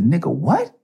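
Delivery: high, sharp retort; retold angry challenge. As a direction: snap back in disbelief. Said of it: "I said".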